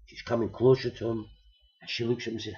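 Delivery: ordinary delivery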